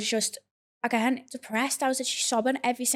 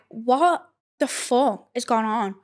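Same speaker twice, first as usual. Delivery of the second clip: drawn-out, mildly shocked question; retold disbelief; 4 dB louder, much slower than usual. Retelling shock at lopsided result.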